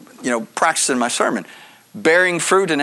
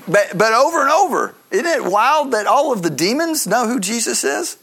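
usual speaking voice

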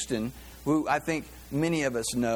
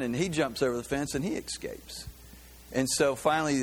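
ordinary delivery

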